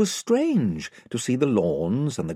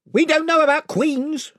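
raised voice of a gang chief; 6 dB louder than usual. A narrator's reading. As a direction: warn them off as spokesman, pitched up. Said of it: "said the chief voice".

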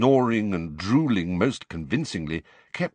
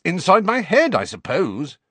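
angry; 11 semitones higher, 5 dB louder than usual. A narrator's reading. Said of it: "said Harry rather angrily".

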